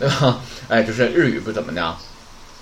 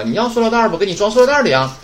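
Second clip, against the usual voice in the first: shop clerk; 8 semitones higher, 4 dB louder than usual. Retold or imitated.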